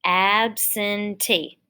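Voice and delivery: stretched out slowly, syllable by syllable; slow blended absentee; slow syllable blending. Shown as a demonstration. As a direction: draw the word out slowly for a child. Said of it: "let's put it together".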